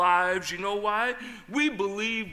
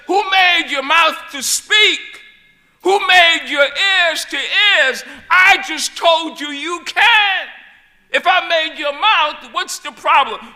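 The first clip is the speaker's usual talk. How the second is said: rebuking shout, higher and louder; angry God; 8 semitones higher, 12 dB louder than usual. Retold or imitated. God angrily rebukes Moses for his excuses, loud. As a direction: scold the doubter from on high. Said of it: "God got angry with Moses".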